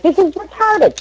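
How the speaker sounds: high-pitched mock disbelief; retold exasperated reaction. Retold own indignant reaction.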